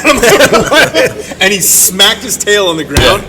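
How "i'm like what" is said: laughing. Retold or imitated. Replying to beaver, laughing.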